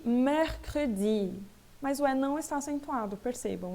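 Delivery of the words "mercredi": mercredi very slowly, syllable by syllable, open first E; careful model reading. Shown as a demonstration. French teacher showing students how to read it, slow and syllabified. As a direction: draw out mercredi with "mehr" wide open. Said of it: "Eu leio isso aqui".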